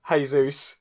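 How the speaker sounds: ordinary delivery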